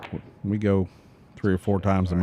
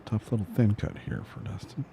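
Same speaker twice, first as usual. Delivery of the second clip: hushed golf commentator voice; golf commentator whisper; 9 dB quieter than usual. Pretend. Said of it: "Yeah, I whispered".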